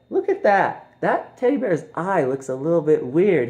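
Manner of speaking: high, lazy mock voice; mock husband. Husband lying down spotting a camera, high mock voice. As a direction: toss it off high. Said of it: "he's all, like, laying down".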